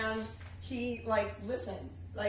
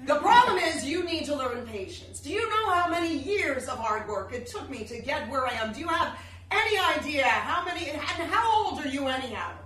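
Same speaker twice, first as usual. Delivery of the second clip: raised, louder hectoring; loud lecture; 5 semitones higher, 9 dB louder than usual. Retold or imitated. Celebrity berating a guest at the dinner table, loud hectoring. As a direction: berate her like a naive youngster.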